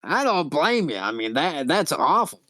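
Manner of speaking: raspy, swooping disapproval; Lady Vera. Acted. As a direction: commiserate in an exaggerated rasp. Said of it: "really raspy voice".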